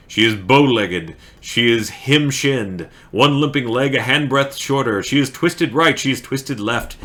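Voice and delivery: emphatic, rhythmic verse reading; poem recitation. Acted. Streamer reading Burns poem for story time, emphatic recitation. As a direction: punch the list, easing off into the refrain. Reads requested poem aloud.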